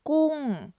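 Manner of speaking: gung loud, starting high and falling; Thai falling tone. Shown as a demonstration. Teacher modelling gung with a falling tone. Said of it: "falling tone in the first word".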